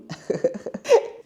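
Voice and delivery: high burst of real laughter; laughing. Real, not acted. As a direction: break into a high giggle mid-story. Laughs while telling her story.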